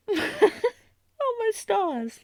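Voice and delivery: high and choked up, close to crying; teary overwhelm. Acted. The prince hearing his knight loves him back, choking up. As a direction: let the joy tip into tears.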